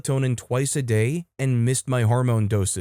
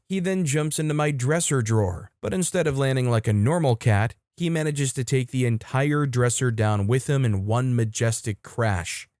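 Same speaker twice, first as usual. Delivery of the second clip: ordinary delivery, nothing marked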